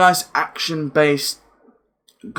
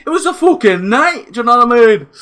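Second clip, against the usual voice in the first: much higher and louder, thrilled; hyped up; 9 semitones higher, 5 dB louder than usual. Real, not acted. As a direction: gush about the wild night. Hyped about fork spot.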